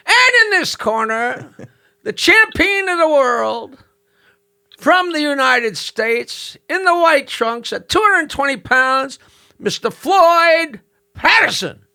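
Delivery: high, slow, drawn-out ring announcer call; boxing ring announcer. Retold or imitated. Comic voicing the announcer introducing the champion, slow and booming. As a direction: stretch every word like a fight announcer. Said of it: "the announcer comes out and says".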